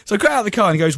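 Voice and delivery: ordinary talk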